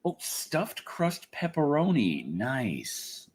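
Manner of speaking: ordinary talk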